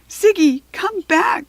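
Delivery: high, loud call after the zebra; girl crying out for Ziggy. A narrator's reading. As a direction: call him back across the plain. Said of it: "cried Rachel".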